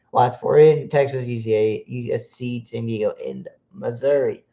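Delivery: ordinary talk, nothing marked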